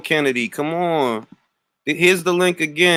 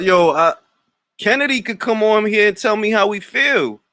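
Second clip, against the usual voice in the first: ordinary talk, nothing marked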